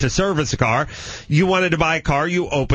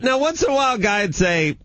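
normal talking voice

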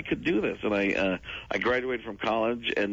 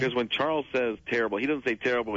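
ordinary talk, nothing marked

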